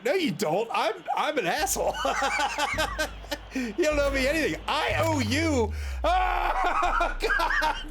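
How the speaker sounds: high, laughing mock outburst; giggling through a mock confession; laughing self-scolding. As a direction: crack up while blurting it out. Laughing at his own outburst.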